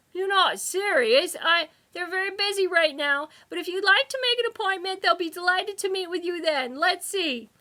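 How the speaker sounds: high, loud clerk's voice; a busy receptionist brushing him off; fussy receptionist. A narrator's reading. Receptionist voice held.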